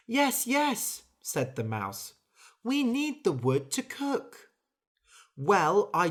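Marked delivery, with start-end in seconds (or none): 0.1-1.0 eager mouse. Acted. Mouse agrees hastily. 2.6-4.5 high squeaky pleading mouse. Acted. Mouse pleading with the bird for the wood.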